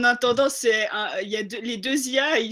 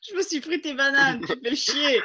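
ordinary talk, nothing marked

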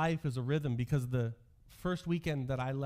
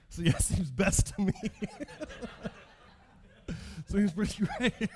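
laughing sarcasm; 7 semitones higher than usual. Retold or imitated. Retelling his defiant answer, laughing.

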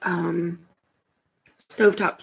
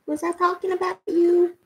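much higher, cooing voice for the cat; 10 semitones higher than usual. Real, not acted. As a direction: tease the cat in a squeaky pet voice. Baby talk to the cat.

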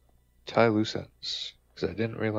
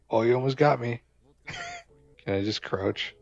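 usual speaking voice

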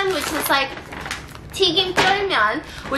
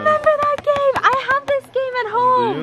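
excited squeal; 12 semitones higher, 3 dB louder than usual. Real, not acted. Excited recognition of game.

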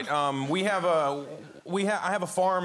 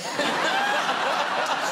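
high, loud burst of laughter; 5 dB louder than usual. Real, not acted. Father retelling son's joke, loud high laugh. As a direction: laugh hard at the punchline.

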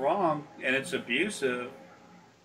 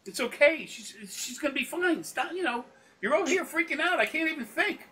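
raised-pitch soothing scold to a panicking mother; higher, firm calming. Retold or imitated. Mechanic to a panicked mother, higher firm calming scold. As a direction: settle her down firmly, higher up. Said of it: "I said".

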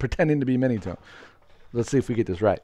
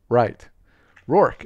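usual speaking voice

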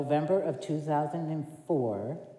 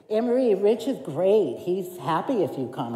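louder, higher, upbeat caller voice; mock phone caller; 5 semitones higher, 7 dB louder than usual. The pastor phoning her back, louder and higher. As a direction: ring her up brightly with good news.

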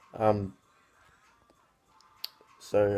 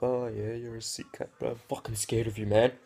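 sarcastic mock fear; 3 dB louder than usual. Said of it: "I'm like".

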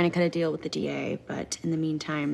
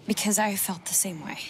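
ordinary talk, nothing marked

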